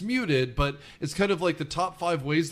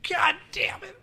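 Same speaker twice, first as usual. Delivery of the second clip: very high strained put-on voice; mock-exasperated, far above usual pitch; hammed-up impersonation. Repeats catchphrase of the Nolte impression.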